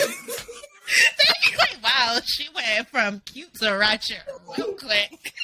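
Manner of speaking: crying with laughter through the words; laughing hard. Real, not acted. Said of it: "laughing while quoting".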